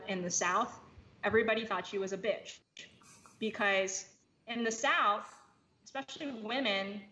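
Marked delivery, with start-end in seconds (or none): none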